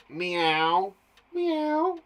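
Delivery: drawn-out mewing meow; stretched, imitated cat call; little cat. Shown as a demonstration. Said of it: "Like a little cat".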